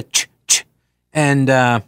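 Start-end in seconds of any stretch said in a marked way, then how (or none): 0.0-0.6 two short, sharp "ch" noises; dog-correcting "ch". Shown as a demonstration. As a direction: do the trainer's quick double "ch". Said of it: "You just do the".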